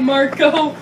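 ordinary delivery

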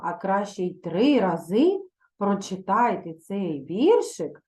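normal talking voice

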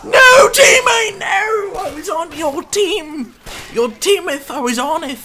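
high, wailing mock-medieval lament; anguished cry. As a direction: wail like a betrayed knight. Betrayed by teammate, crying out.

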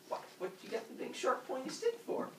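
high, nervous questioning; high cartoon worry; wary Father Sky. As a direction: ask it high-pitched, in comic suspicion.

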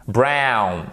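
"Brown" with a gliding ow vowel; diphthong in brown. Shown as a demonstration. Teacher modelling English for French learners, full diphthong in "Brown". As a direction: glide from ah to oo inside "Brown". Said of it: "savoir faire les diphtongues".

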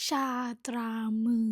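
ชาตรามือ with a clear Thai มือ vowel; ชาตรามือ said quickly, the native way; native Thai pronunciation. Shown as a demonstration. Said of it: "In Thai accent".

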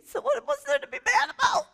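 very high, laughing squeal of disbelief. Real, not acted. Preacher asking congregation what's left to resent, laughing incredulously. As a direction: ask it through laughter, voice shooting up. Joyful rhetorical question after retold refusal.

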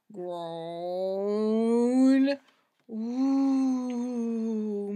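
low drawn ghost groan; ghostly moan. Acted. Ghost moans from behind the door, low drawn-out. Spooky sound effects.

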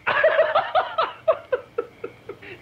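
high-pitched giggling fit; helpless laughter. Real, not acted. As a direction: lose it before the punchline. Cannot finish the punchline.

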